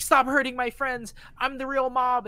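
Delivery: high, loud defiant claim; a hidden self demanding to be heard; Shigeo's other self. Retold or imitated. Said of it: "because he's like".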